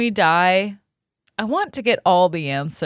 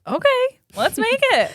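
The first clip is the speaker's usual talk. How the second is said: excited; 13 semitones higher than usual. Real, not acted. Excited agreement to the idea.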